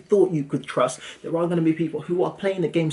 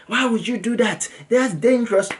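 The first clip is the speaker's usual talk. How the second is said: a light mock of a worried mum, higher; imitating mum; 6 semitones higher than usual. Mum scolding her son over the risky fight, mock higher voice. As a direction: play mum scolding, pitch up, half in jest.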